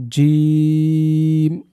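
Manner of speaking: জিম held very long; drawn-out letter. Shown as a demonstration. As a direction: stretch জিম slowly. Teacher models letter. (জিম অনেক টেনে)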